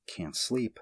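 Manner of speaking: plain reading voice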